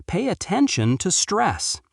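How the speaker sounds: normal talking voice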